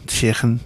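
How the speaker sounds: "Chicken" with a scraped, throaty Scouse K; Scouse pronunciation. Shown as a demonstration. Host coaching a Scouse accent, "Chicken" with a rasped kh. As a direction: say "Chicken" Scouse-style, the middle K softened to a kh. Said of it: "Twenty-seven Ks".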